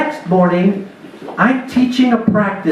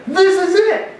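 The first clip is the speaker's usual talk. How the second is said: higher, eager inner voice, psyched for revenge; pumped-up inner vow; 8 semitones higher than usual. Retold or imitated. Outfielder to himself, higher, eager and psyched. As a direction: hype yourself up to take him down. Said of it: "and I'm thinking".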